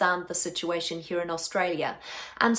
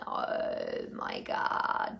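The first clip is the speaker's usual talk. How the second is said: much lower, creaky, dragged-out fry; vocal fry; 7 dB quieter, much slower than usual. Shown as a demonstration. Voice coach demonstrating fry for the audience, much lower creaky drawl. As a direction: drop into a rattling throat creak, slowly. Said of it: "you create a fry, as in".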